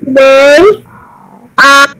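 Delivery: slow, word by word with gaps; slow repetition. Shown as a demonstration. Student answers the teacher's question, slowly word by word. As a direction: draw out each word separately.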